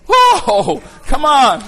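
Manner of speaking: high, loud preacher's whoop; exultant shout. Real, not acted. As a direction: shout it out to rouse the congregation. Preacher exclaims after conversion story.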